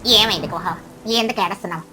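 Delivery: kindly crow, concerned. Acted. Crow asking the weeping duck what happened, concerned. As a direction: question a friend in a low character voice.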